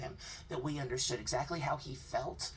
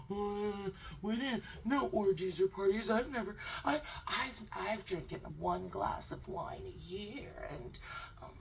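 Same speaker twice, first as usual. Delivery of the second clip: nervous mock denial; 4 semitones higher than usual. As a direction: play a jittery liar swearing he's clean. Imitating the guest's nervous denials.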